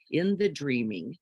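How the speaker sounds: usual speaking voice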